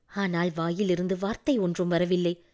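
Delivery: ordinary delivery, nothing marked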